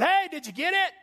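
put-on high, bright-eyed call to a kid on a bike; excited greeting. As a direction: sound thrilled for him. Preacher greets excited young biker.

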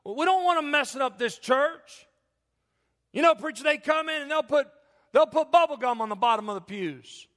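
high, loud alarmed "whoa whoa"; fussing disciples. Retold or imitated. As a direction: flap like a nervous church usher. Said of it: "And the disciples like".

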